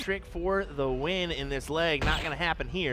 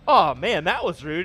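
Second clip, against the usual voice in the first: mock offended; 2 semitones higher, 9 dB louder than usual. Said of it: "And I said".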